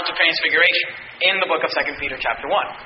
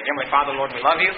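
low, soft pastoral prayer; 8 semitones lower, 3 dB quieter than usual. Real, not acted. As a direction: pray quietly over the congregation. Closing prayer.

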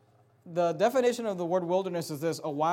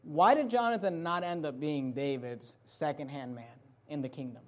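ordinary talk, nothing marked

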